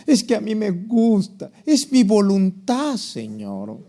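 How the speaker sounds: mock pleading, swelling high then dropping on Señor; hammed-up wilful believer praying; self-willed prayer. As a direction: plead your own wishes to God, drawn out. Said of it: "¿dónde sacas tú?".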